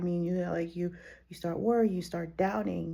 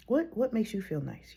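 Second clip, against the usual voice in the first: ordinary talk, nothing marked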